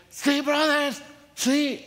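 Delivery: high, loud Pentecostal-style shout; hammed-up revival preacher cry; imitating a shouting preacher. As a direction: mimic the loud guy rousing the room. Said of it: "He was shouting and screaming".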